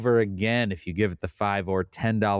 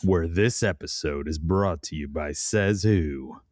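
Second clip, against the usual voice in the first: movie trailer announcer; 2 semitones lower, slower than usual. Pretend. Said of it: "I sound like a professional announcer".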